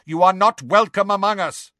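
high, loud warning call. A narrator's reading. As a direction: warn off a giant at full voice. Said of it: "called Megorian".

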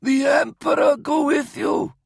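high, strained, forceful croak. A narrator's reading. Wounded major with bandaged throat to officer, croaked. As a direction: force the blessing out through an injured throat. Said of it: "Major Pasha croaked to Hark".

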